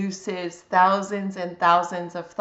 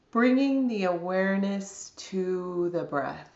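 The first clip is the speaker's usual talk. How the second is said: calm, unhurried body scan guidance with long pauses; guided meditation. Real, not acted.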